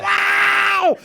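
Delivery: high, loud whoop of joy; joyful scream. Retold or imitated. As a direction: scream it with delight. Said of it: "it was just going".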